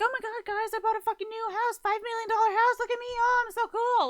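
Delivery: shrieky, high-pitched loud gush; over-the-top excited bragging, loud and high; mock bragging. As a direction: parody a show-off squealing over a purchase. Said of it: "I'm not gonna be like".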